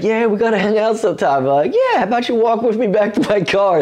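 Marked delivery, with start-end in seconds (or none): 0.0-1.4 high, quick mock-friendly invitation; breezy put-on eagerness; eager flirting. 1.7-3.8 high, fast mock-eager suggestion; put-on high-pitched coaxing.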